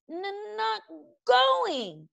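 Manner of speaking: very high-pitched, stammering creature voice; stubborn dragon. A narrator's reading. Toothless clinging to Hiccup's leg, stubborn high refusal. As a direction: dig in stubbornly in a high little voice. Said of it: "said Toothless stubbornly".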